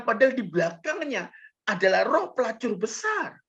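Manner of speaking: high, loud, fiery pulpit denunciation; preaching at full force. Acted. Preacher denouncing charm money to his congregation, fiery and loud.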